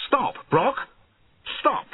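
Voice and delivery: high-pitched urgent shout; sudden high cry of command; loud sudden shout. A narrator's reading. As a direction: shout it to halt someone riding along. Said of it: "and suddenly shouted loudly".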